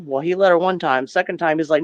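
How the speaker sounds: usual speaking voice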